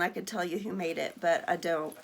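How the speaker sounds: ordinary talk, nothing marked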